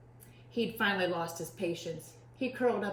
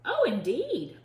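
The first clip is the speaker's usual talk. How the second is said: high, louder proper voice; prim rascal; 8 semitones higher, 6 dB louder than usual. A narrator's reading. Rascal replying to Pete, high prim voice. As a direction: chirp it primly.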